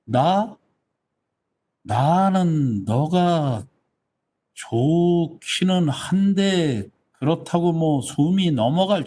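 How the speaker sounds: drawn-out, wavering confession; noncommittal hedging. Acted. Shy villager half-confessing liking to someone, noncommittal. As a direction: stall and stretch each phrase without committing. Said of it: "결론 안 내리고".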